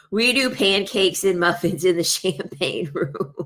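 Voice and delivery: breaking into laughter toward the end; laughing. Real, not acted. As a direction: try to finish through giggles. Cracks up at own joke.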